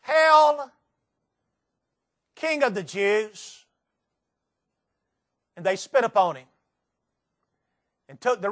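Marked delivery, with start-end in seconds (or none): 0.0-3.6 mocking soldiers. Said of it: "mocked him, saying".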